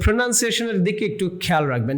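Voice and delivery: normal talking voice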